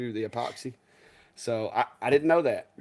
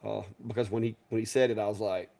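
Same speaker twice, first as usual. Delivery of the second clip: ordinary talk, nothing marked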